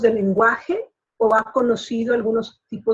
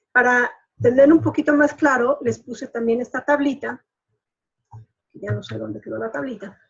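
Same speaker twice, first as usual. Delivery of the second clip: normal talking voice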